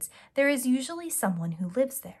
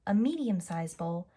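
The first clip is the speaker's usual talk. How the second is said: plain reading voice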